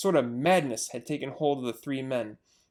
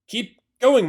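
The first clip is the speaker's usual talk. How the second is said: panted; 6 semitones higher, 9 dB louder than usual. Acted. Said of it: "panted Mister Fox".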